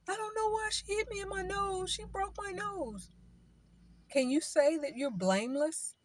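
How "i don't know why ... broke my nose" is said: high, put-on complaining voice; high mock whine of a hurt victim; mock whiny victim. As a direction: play the innocent who got hit. Mock victim after hitting.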